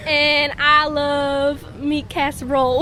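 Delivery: put-on a girl introducing herself in a North Carolina accent. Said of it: "That accent".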